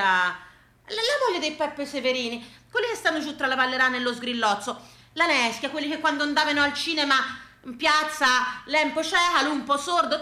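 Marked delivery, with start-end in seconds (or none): none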